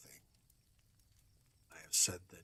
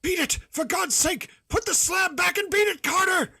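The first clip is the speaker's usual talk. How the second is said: much higher, much louder desperate shout; frantic terrified cry; 17 dB louder than usual. Acted. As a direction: scream the warning up from below in panic. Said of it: "a piteous cry from Warren".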